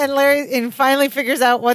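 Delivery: ordinary delivery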